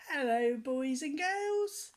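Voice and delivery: high, slow, sing-song clown greeting; Humpty Dumpty greeting. Retold or imitated. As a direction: stretch it out high like a pantomime egg. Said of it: "he always said".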